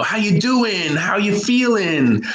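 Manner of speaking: high, upbeat friendly check-in; bright high casual greeting; chatty American greeting. Retold or imitated. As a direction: greet like a chummy American buddy. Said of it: "like, how we do in America".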